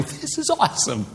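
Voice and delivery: high, hammed-up delight; amazed crowd. Onlookers praise God after the healing, amazed. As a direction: exclaim in wonder, pitch jumping up. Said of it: "all the people around are going".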